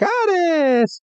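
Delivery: very high, squeaky creature cry; mock baby-creature chirp; imitated Oddish. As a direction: squeal out the name, held high. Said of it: "Oddish is like".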